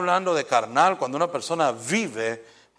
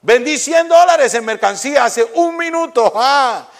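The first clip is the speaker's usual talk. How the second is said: loud, high crowing laugh and boast; gloating shopkeeper; 12 semitones higher, 12 dB louder, slower than usual. Retold or imitated. Shopkeeper yelling across the street at his rival, gloating. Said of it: "Cuando le llegaban".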